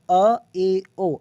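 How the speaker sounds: अ, ए, ओ one by one, mouth half open; half-open vowels. Shown as a demonstration. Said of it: "आधा open हो रहा है". (आधे खुले मुंह से स्वर)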